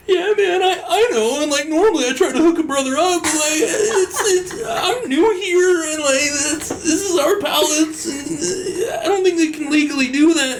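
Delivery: high strained whiny voice, way up; whiny guard. Retold or imitated. New guard explaining to coworkers in a high whine. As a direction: nervously defend the pallets, squeaky and half laughing.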